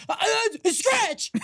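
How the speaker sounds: high, stammering scratch outburst; imitating a friend's panicked yell; panicked blurt. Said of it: "Harris goes".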